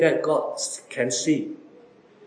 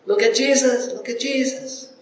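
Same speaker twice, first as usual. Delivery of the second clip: a lightly voiced eager crowd, pitched up; excited crowd; 6 semitones higher than usual. A lightly voiced crowd calling out to each other about Jesus. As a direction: call out like a thrilled onlooker. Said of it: "many people around crying".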